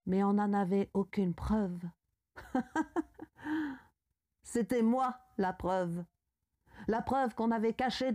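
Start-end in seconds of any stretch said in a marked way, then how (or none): 2.4-3.7 high staccato laugh; short pulsing laugh, pitched well up. Acted. The accused laughs over the lack of proof, high staccato laugh.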